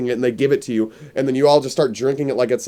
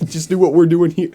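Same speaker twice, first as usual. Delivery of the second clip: ordinary delivery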